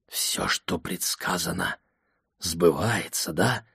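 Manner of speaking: soft, slow hushed question; whispering. A narrator's reading. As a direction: breathe it quietly at the bedside. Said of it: "прошептал я".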